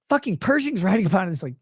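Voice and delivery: ordinary talk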